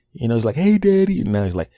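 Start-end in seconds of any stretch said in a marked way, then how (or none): 0.6-1.2 put-on much higher, louder sweet child greeting; little girl voice. Dad imitating his little daughter greeting him, high and sweet. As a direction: squeak it like a tiny daughter. Father voicing his young son.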